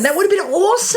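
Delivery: giddy rising awesome; delighted. Real, not acted. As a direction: squeal it with glee. Excited gushing, laughing.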